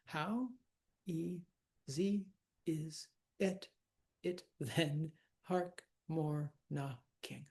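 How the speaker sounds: broken syllable by syllable, softly; slow, separated syllables, as if tallying; counting syllables. Shown as a demonstration. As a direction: count out each syllable with gaps. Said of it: "when you count the syllables".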